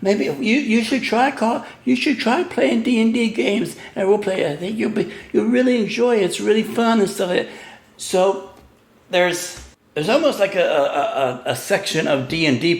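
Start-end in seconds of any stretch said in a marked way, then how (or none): 0.0-7.5 higher, lilting imitation of well-meaning coaxing; mock sympathetic. Gamers urging the wrestler to try playing, imitated sympathetic coaxing. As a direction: coax in a raised, put-on sympathetic voice. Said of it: "even taking, like, a sympathetic thing".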